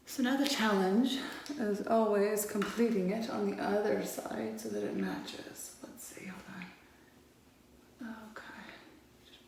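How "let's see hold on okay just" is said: concentrating whisper. Real, not acted. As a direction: hush it while focusing. Concentrating, muttering while drawing liner.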